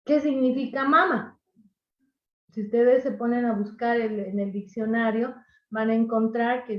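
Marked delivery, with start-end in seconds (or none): 0.8-1.4 "mama" as MA-ma, second syllable falling; stress on first syllable. Shown as a demonstration. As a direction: punch the first ma of "mama", drop the last. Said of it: "¿Qué pasa si le quito el acento?".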